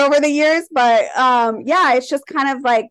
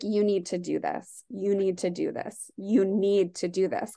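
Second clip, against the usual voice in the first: much softer, hushed insistent repeat; voice of the universe; 4 semitones lower, 9 dB quieter than usual. Retold or imitated. Quoting the universe's call to open her shop, hushed and insistent. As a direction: murmur it like a quiet inner calling. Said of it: "the universe and spirit was just like".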